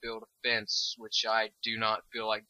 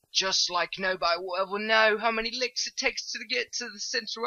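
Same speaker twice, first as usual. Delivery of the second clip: higher, bubbly British accent chatter; excited British accent; 11 semitones higher than usual. Real, not acted. Admiring her cows, chatting in an excited British accent. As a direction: slip into a gleeful British accent. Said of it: "went into an involuntary British accent".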